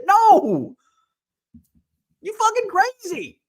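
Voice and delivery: high, loud scoffing no; incredulous shout. Pretend. Mocking the idea of running an auction alone, loud disbelief. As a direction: blurt it like the idea is insane. Mock answer to own question.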